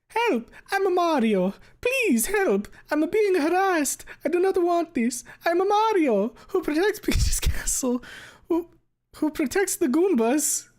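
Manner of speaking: very high, loud cartoon plea for help; Mario. Streamer reads Mario's plea from the fic, squeaky hammed-up distress. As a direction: squeal like a panicked cartoon plumber. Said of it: "I don't even know if I have a fucking Mario voice".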